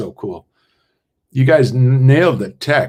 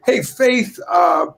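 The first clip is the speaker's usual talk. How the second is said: higher, calling her name to cut in; 10 semitones higher, slower than usual. Real, not acted. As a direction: hail her across the call. Calling out to castmate.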